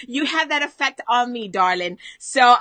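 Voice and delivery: ordinary delivery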